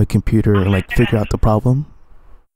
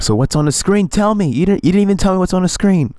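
pitched way up, insistent; exasperated demand; 9 semitones higher than usual. Real, not acted. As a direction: push him hard for an answer.

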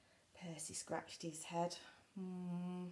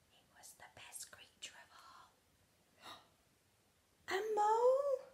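whisper then excited gasp; 6 dB louder than usual. A narrator's reading. Dramatic reveal whisper then gasp.

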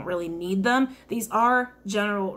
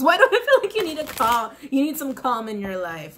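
ordinary delivery

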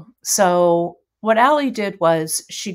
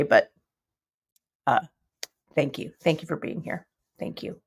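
teary thanks, much softer and fading out; choked up; 5 semitones lower, 7 dB quieter than usual. Real, not acted. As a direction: thank them while holding back tears. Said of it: "I'm so emotion".

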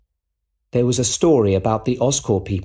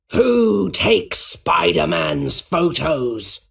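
very slow, raised villain shout; villain's shout; 5 semitones higher, much slower than usual. A narrator's reading. Goblin threatens Mr Jameson for a name, shouting. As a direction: demand it in a drawn-out villain voice. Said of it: "he shouted".

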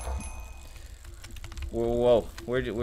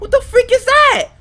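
scared yell; 11 dB louder than usual. Real, not acted. Sees a monster chasing him.

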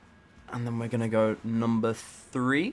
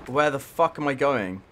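ordinary talk, nothing marked

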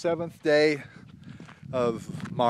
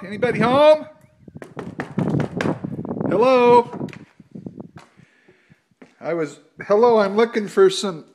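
loud, high call into the empty store; calling down a hallway; 9 semitones higher, 10 dB louder than usual. Real, not acted. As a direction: shout out to find someone in back.

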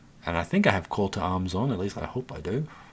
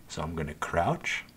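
lowered, softer voice while sneaking; hushed; 6 semitones lower, 6 dB quieter than usual. Real, not acted. Gamer sneaking into the enemy base, hushed and lower. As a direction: drop the voice low as if hiding. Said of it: "Why am I whispering?".

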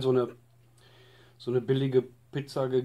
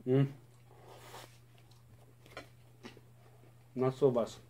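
chewing while speaking; eating calzone; mouth full; 6 dB quieter than usual. Real, not acted. Seen 1: Holds bitten calzone, mouth working on food after the bite.

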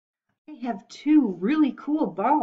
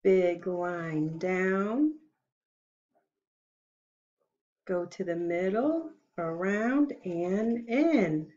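very slow, softer guiding voice; slow writing instructions; 3 semitones lower, 4 dB quieter than usual. Shown as a demonstration. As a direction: guide little fingers stroke by stroke. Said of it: "Let's begin".

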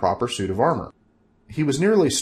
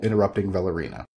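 usual speaking voice

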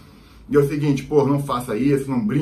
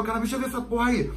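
impatient demand; 10 semitones higher, 3 dB quieter, faster than usual. Retold or imitated. Officer demands the bag.

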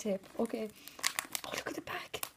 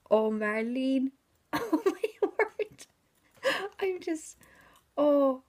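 much higher and louder, laughing and breathless; overjoyed; 5 semitones higher, 9 dB louder, much slower than usual. Real, not acted. Thanking Marlene for the gift, bursting with joyful laughter. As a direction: squeal with delight, almost crying.

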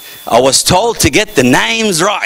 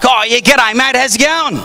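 higher-pitched mock Aussie greeting; broad Australian accent; 6 semitones higher than usual. Aussie at a barbecue greeting newcomer, mock Australian accent. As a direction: imitate an Australian mate saying hello. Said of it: "compared to their accent".

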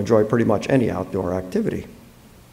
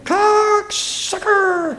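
high, drawn-out parrot screech; fractured bird call; much slower than usual. A narrator's reading. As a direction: stretch it out slowly in a high bird squawk. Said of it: "the fractured call that awakened me".

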